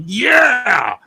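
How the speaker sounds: high, loud, drawn-out cheer; elated cheer. Retold or imitated. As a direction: cheer it like a thrilled fan. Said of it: "I was like".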